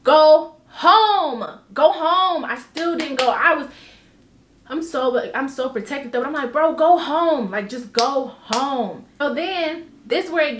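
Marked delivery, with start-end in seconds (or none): 0.0-2.5 loud go home. Real, not acted. Telling herself to leave. 6.5-9.0 slow urging. Retold or imitated. Retelling urging herself.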